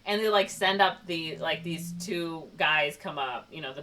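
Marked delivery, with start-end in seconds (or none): none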